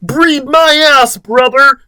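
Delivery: very high mock yell; hammed-up high holler; Chris. A narrator's reading. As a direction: shriek it as an urgent demand. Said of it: "Chris yells".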